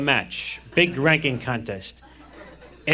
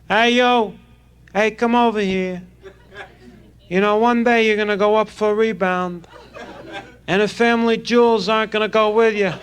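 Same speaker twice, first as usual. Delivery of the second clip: gym teacher voice; 8 semitones higher than usual. Retold or imitated. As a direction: yell like a gym coach. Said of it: "He speaks gym".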